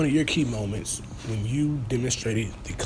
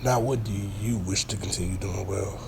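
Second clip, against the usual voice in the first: annoyed interviewer; 5 semitones lower than usual. Acted. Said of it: "Oh, my bad. I forgot we was on interview".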